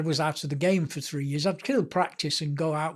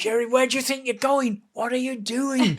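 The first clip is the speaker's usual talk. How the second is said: high, strained mock sideline yell; imitated manager shouting; 8 semitones higher than usual. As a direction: act out an exasperated manager yelling from the touchline. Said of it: "shouting his head off".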